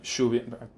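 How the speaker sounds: lluvia with the double L said as sh, shoo-vee-ah; Spanish double L. Shown as a demonstration. Teaching her own Spanish word for rain, lluvia said with sh. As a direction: say lluvia the way she says it, sh at the start. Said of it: "which is the sound of the double L".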